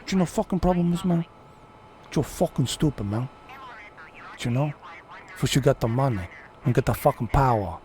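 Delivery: put-on Cuban gangster voice; thick-accented movie gangster, laying down the law; Tony Montana. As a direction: play a swaggering Cuban crime boss. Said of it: "I'm fucking Tony Montana".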